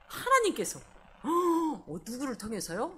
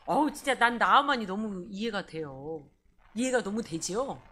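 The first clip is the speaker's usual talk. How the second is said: ordinary delivery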